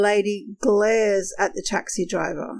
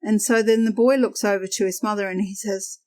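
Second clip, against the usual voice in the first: ordinary talk, nothing marked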